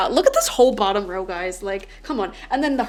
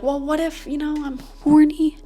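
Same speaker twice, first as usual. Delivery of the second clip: whiny inner voice; 6 semitones higher, 4 dB louder than usual. Retold or imitated. Voicing viewer's worried inner thoughts.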